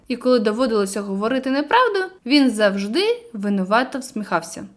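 ordinary delivery

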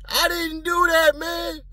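high mock yell of denial; high-pitched imitated denial. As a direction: squeal out a fake protest of innocence. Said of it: "yell out".

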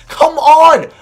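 high, loud drawn-out cry of protest; exasperated yell. Real, not acted. Gamer to the screen as a shot drops, exasperated yell. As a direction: yell it at the game in disbelief. Rages at opponent's shot going in.

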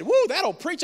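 high, loud whoop of approval; retold cheer from an excited wife. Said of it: "and Megan said".

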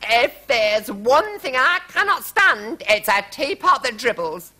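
high, slow, indignant royal voice; indignant queen. A narrator's reading. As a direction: declare it with royal outrage. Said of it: "cried the Queen".

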